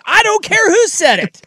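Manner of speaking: high, loud mock yell; imitated umpire's outburst. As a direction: retell it as an indignant high-pitched shout. Acting out the umpire's angry ejection.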